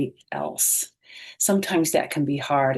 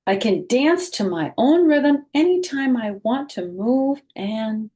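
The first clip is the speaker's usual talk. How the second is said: bright, expressive picture-book read-aloud; reading to children; 2 semitones higher than usual. A narrator's reading. Teacher reading a picture book aloud to children, expressive. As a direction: read it slowly and warmly to young listeners.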